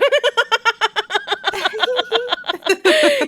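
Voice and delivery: long, high-pitched giddy laugh; real gleeful laughing fit; delighted laughter. Real, not acted. Laughs amid the delighted retelling.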